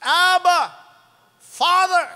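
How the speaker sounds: loud, high cry to God. Acted. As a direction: cry it out with full voice, each word on its own. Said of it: "into your hearts crying out".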